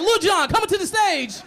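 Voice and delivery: high, loud, hyped stage announcement; hype announcer. Retold or imitated. Comic mocking the concert rallies, loud MC call. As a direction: announce him like an MC. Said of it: "They like".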